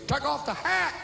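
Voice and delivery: high-pitched mock angry order; pissy. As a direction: imitate an irritated bouncer, pitch jumping way up. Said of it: "this guy, real pissy".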